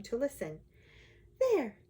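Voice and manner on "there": higher-voiced, light sigh of relief; a high, satisfied little exclamation. A narrator's reading. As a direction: lift it high, as if sighing. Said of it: "she sighed".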